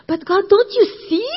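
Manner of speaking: high, loud plea; pleading. Retold or imitated. Jesse protesting to God, high and loud. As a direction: cry out in protest.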